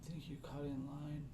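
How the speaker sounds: put-on whispery little old gnome. Old gnome whispering to someone who cut in line. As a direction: complain in a very soft, whispered voice. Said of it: "he looks really old".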